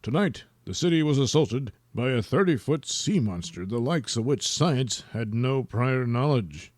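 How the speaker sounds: low, measured anchorman read; news anchor. Acted. Wiener Wanker reporting the monster attack, low anchorman voice. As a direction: deliver the news with mock gravity.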